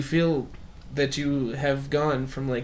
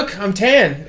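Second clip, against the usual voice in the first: slightly higher, louder boast; mock proud announcement; 4 semitones higher, 6 dB louder, slower than usual. Pale speaker tells people about the pink skin, mock proud. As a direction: show it off proudly.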